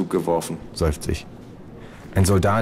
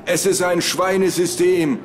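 much higher, slow mock shout; mock scream; 13 semitones higher, slower than usual. Narrator imitating a jumper's cry, a high slow mock yell. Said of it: "dabei schreien".

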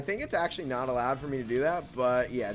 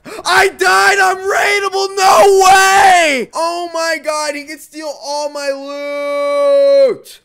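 screaming despair, much higher and louder; anguished scream; 13 semitones higher, 17 dB louder, much slower than usual. Real, not acted. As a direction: scream in panic at losing. Dies, now raidable.

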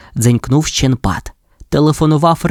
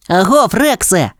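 higher, eager call to a friend; exclaimed greeting; 7 semitones higher than usual. A narrator's reading. Marshall hails Rex on arriving, calling out. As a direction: call out in a lifted pup voice. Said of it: "вигукнув маршал".

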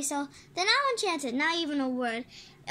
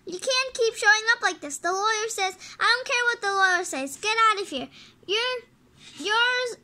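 acted angry parent, voice raised and higher; indignant domestic row; heated argument; 4 semitones higher, 5 dB louder than usual. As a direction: argue as if throwing someone out. Parents quarrel in the story.

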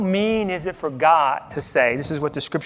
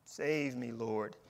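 quiet plea; 6 semitones lower, 11 dB quieter, slower than usual. Retold or imitated. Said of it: "he shouted".

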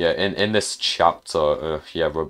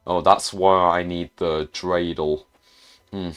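usual speaking voice

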